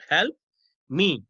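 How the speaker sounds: "Help", pause, then "me" on its own; word-by-word dictation. Shown as a demonstration. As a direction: split "Help" and "me" with a clear gap. Repeats example word by word.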